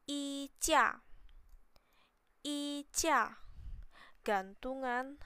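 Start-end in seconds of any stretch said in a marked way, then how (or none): 0.0-3.4 衣架 said higher, clearly; vocab drill. Shown as a demonstration. Teacher reading 衣架 aloud for learners. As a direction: read 衣架 twice, clearly tuned. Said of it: "Lanjut ya".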